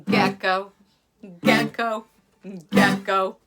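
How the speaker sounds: loud chanted gecko cry; repeated loud gecko call. Acted. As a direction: call out the gecko's name like the lizard's croak. Gecko calling out at night.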